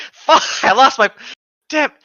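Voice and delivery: loud, laughing frustrated curse; frustrated outburst. Real, not acted. Reader loses the line, a loud laughing curse. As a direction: blurt out annoyance at losing the place. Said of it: "lost their place while reading".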